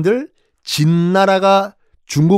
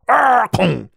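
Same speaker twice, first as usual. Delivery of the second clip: normal talking voice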